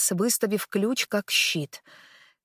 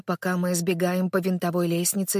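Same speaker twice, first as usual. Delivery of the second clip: usual speaking voice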